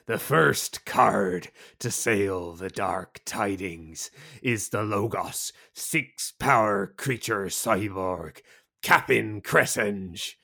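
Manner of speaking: put-on slow, low pirate accent opening on a whispered greeting. Announcing the new card to listeners in a pirate accent. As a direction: growl it out like a pirate captain, slowly. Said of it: "that was my best pirate accent".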